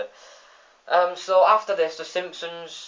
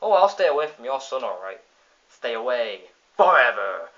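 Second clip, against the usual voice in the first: sinister Bob; 2 semitones lower, 4 dB louder than usual. Retold or imitated. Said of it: "Sideshow Bob says".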